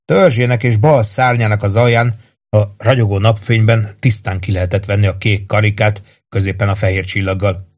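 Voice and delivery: usual speaking voice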